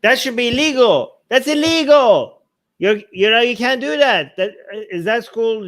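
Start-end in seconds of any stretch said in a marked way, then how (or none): 0.0-2.4 outraged. Real, not acted. Host outraged by a school teaching against evolution, loud indignant. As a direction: hit it hard with real outrage.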